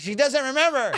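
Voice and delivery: high, frantic, laughing; exasperated protest. Retold or imitated. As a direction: insist in mock panic, nearly cracking up. Frantic repeated protest, breaking into laughter.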